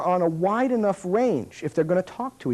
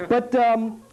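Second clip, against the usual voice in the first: usual speaking voice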